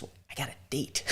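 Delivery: whispered under breath to the boss; hushed confession. Retold or imitated. Whispering to his boss about the date. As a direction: lean over the cubicle and whisper it.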